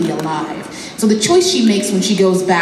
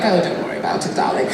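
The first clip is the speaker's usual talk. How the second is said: whispered, lightly British reassurance; light British accent; 4 dB quieter, faster than usual. Retold or imitated. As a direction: breathe it softly like a posh friend. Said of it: "He's British".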